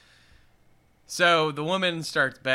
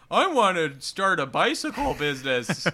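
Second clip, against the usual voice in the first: higher, louder eager customer with a laugh in it; hammed-up hopeful borrower; silly loan applicant; 6 semitones higher, 5 dB louder than usual. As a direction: play an overeager would-be shop owner.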